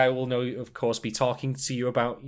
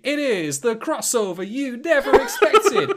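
higher, louder, slow boxing announcer build-up; ring announcer; 11 semitones higher, 7 dB louder, slower than usual. Pretend. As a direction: draw out the fighter intro like a ring announcer.

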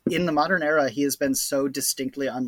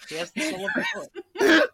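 mock dramatic; 7 dB louder than usual. Joking about Colossus.

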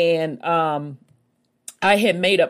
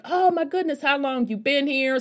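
much higher, retelling her own shocked reply; 9 semitones higher than usual. Retold or imitated. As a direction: gush with surprise. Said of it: "I was shocked".

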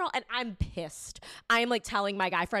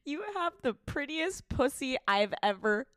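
cracking up; 4 semitones higher, slower than usual. Real, not acted. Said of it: "I can't even say the words".

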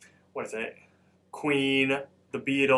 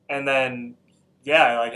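usual speaking voice

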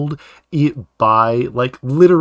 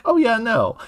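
Mako's awkward brush-off; 11 semitones higher than usual. Retold or imitated. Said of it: "when he was like".

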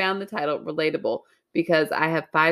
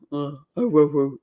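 talking dog imitation; 4 semitones lower than usual. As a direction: imitate a dog trying to say words. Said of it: "in dog talk".

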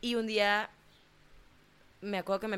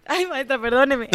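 loud, higher mock begging; hammed-up plea to the teacher Viviana; 6 semitones higher, 11 dB louder than usual. Mock pleading to teacher, laughs.

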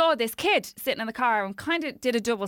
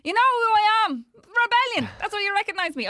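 put-on high, louder kid calling out; boastful kid; 12 semitones higher, 4 dB louder than usual. Kid actor calling out the car window, high and loud. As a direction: shout it proud from the car window.

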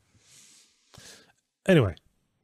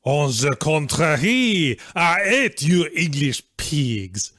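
slow, higher French accent, hammed up; 4 semitones higher, slower than usual. Quoting a Blackadder line to get into the accent, hammed-up French accent. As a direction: play a comic Frenchman with a heavy French accent. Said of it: "said in a French accent".

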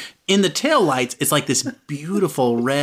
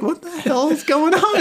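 much higher voice, imitating her bafflement; mock incredulous question, pitched way up; bewildered; 12 semitones higher than usual. Girl's bewildered reaction, acted.

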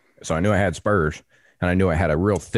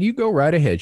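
higher, raised-voice retort; retold calling back; 11 semitones higher, 4 dB louder than usual. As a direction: toss the answer back over your shoulder. Hunter answers her threat from afar.

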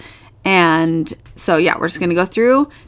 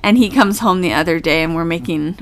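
ordinary delivery

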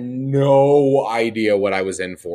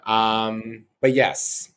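ordinary talk

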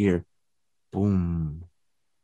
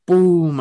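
a sharp boom, higher and louder, like a fist on the wall; mock wall knock; 7 semitones higher, 6 dB louder than usual. Showing the ghost how to knock, loud knock sound. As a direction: punch out a single knock on the wall. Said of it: "Now I banged the wall".

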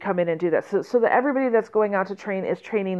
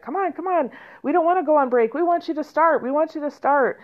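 higher, pleading come on, we want you to start; lightly voiced excited students; eager kids begging; 7 semitones higher than usual. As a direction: voice the eager kids tugging at your arms. Eager students pulling them in.

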